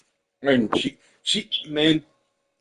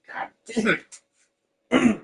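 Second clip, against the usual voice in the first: choked up; 10 semitones higher, 4 dB quieter, much slower than usual. Real, not acted. Overwhelmed by kindness.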